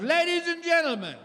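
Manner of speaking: high, showy announcer voice; grand announcement. Retold or imitated. Restaurant manager announcing the anniversary to everyone, showy and high. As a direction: announce it to the whole room.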